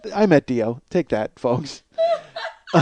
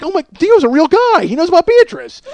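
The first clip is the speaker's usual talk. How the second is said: much higher and louder, amazed; stunned excitement; 14 semitones higher, 6 dB louder than usual. Retold or imitated. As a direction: gush like starstruck fans. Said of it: "my buddy Brian and I are like".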